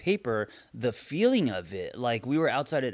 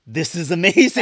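excited; 5 semitones higher, 9 dB louder than usual. Retold or imitated. Client gushing on the walk.

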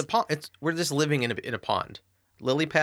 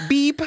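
censor beep; 14 semitones higher, 7 dB louder than usual. Pretend.

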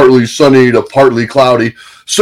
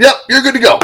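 high, breezy mechanic voice; cheerful brush-off from the mechanic; mock mechanic; 11 semitones higher, 3 dB louder, faster than usual. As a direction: toss it off lightly, far higher. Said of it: "all of a sudden".

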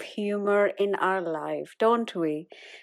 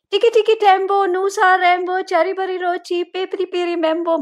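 put-on calling a child; 8 semitones higher, faster than usual. Mother calling her child from her room, high and projected. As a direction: call her name up the stairs, pitched high. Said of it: "I'm trying to call her".

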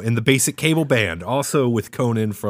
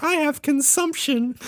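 put-on high, thin voice of a sick little boy; sickly child. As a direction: croak it out like a feeble Victorian kid. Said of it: "And he's like".

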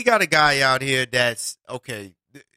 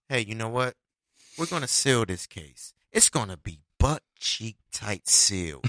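lowered, quieter voice of the powers that be; mock authority voice; 9 semitones lower, 7 dB quieter than usual. Host voicing the higher power sealing the case, lowered. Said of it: "the higher power come around and says".

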